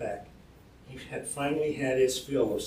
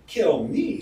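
raised, louder outcry; crying out; 6 semitones higher, 7 dB louder than usual. A narrator's reading. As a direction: cry it out as a scornful challenge. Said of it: "Sumner cried out".

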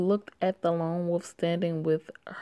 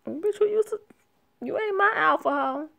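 sassy comeback; 12 semitones higher, 3 dB louder than usual. Acted. Answering the alpha in character.

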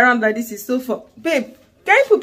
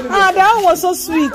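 higher, louder, slower gushing aw; touched and tender; 7 semitones higher, 5 dB louder than usual. Real, not acted. Mum moved by her son hugging his cousin, gushing tenderly. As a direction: melt at the sweet hug.

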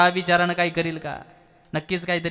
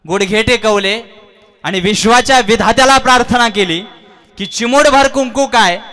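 loud, much higher, rising preacher's cry; impassioned preaching; 7 semitones higher, 12 dB louder than usual. Acted. Hanuman praying to the Lord of the world, loud high fervent cry. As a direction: build the prayer to a loud devoted climax.

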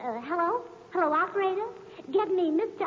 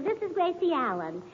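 normal talking voice

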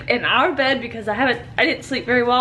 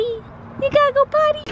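high cooing puppy voice; high pet talk; 11 semitones higher than usual. Real, not acted. Talking to the dog.

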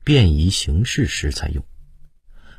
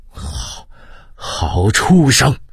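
furious curse; 7 semitones higher, much slower than usual. A narrator's reading. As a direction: spit out the insult, slowly. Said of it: "康熙叫骂道".

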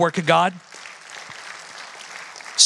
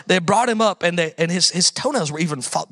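usual speaking voice

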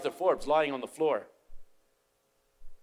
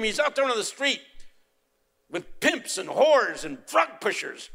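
accusing yell, louder; angry father blaming the teacher, louder and higher; 11 semitones higher, 8 dB louder than usual. Retold or imitated. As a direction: yell it at the professor. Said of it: "yelled at me".

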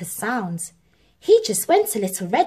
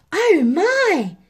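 high, loud startled oh my; gasping surprise; 9 semitones higher, 6 dB louder than usual. A narrator's reading. Harry watching the cat climb, surprised. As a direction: gasp at what you see. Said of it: "said Harry".

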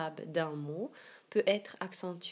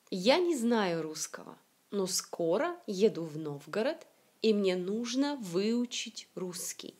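connected model reading, "знаю", "русского", "скоро" stressed at the start; first-syllable stress. Shown as a demonstration. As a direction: hit the first syllable of "Новгород" and "выучить" in a flowing sentence. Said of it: "toutes les syllabes accentuées sont les premières syllabes".

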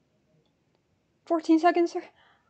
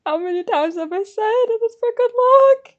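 rising, louder comic protest; mock exasperation; 7 semitones higher, 5 dB louder than usual. Real, not acted. Explaining a good-luck charm yet again, mock exasperation. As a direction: climb higher to a loud finish.

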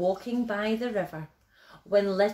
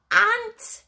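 high, loud burst of delight; thrilled little bear's high cry; delighted discovery; 14 semitones higher, 8 dB louder than usual. A narrator's reading. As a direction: exclaim it with glee after a gasp. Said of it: "he laughed".

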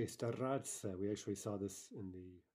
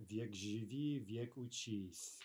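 slow proverb; slower than usual. Shown as a demonstration. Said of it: "a very commonly heard пословица".

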